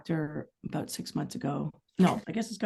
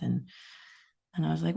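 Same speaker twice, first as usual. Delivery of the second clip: usual speaking voice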